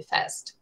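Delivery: "fast" whispered, crisp F at the start and T at the end; whispered word. Shown as a demonstration. Teacher shows students the sounds of "fast", whispered. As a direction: breathe out "fast" so the F and T stand out. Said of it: "começa no F e acaba no T".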